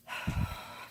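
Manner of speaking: heavy, winded sigh out; breathless sigh. Real, not acted. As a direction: let out a tired sigh. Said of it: "Sorry, I'm out of breath".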